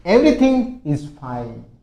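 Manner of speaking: very slow and loud; careful word by word; slow classroom read; slow model sentence. Shown as a demonstration. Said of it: "देखिए".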